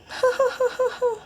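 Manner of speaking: high-pitched string of giggles. Real, not acted. Vlogger tickled at being punny, giggle. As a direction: giggle in a quick, high run.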